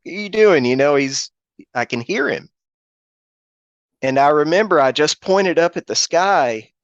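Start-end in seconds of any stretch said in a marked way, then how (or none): 0.1-0.6 quick, high-pitched mock swearing; imitated cussing. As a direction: mimic a friend cussing at you, without shouting. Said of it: "And he's cussing".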